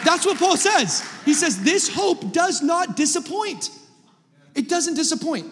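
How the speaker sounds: high, ringing preacher's cry; soaring pulpit shout; full-force preaching. Acted. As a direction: lift the room with the promise. Preaching climax, high and far.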